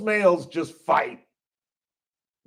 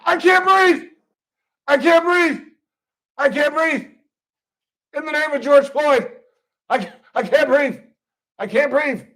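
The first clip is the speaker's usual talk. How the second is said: much higher, loud gasping plea; dramatic arrestee; 8 semitones higher, 4 dB louder than usual. Retold or imitated. Arrestee loudly claiming he can't breathe, hammed-up and high. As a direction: ham up the struggle to breathe. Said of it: "the final, number one thing".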